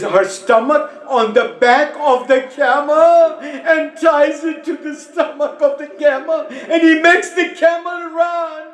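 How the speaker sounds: high keening preacher's lament, rising to a cry; wailing majlis recitation. Acted. Preacher to mourners, high wailing lament. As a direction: chant the tragedy so the hall weeps.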